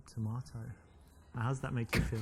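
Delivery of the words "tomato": tomato said with a broad ah, toh-MAH-to; British tomato. Shown as a demonstration. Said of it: "with my English accent".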